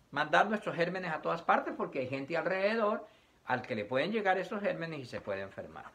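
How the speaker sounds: normal talking voice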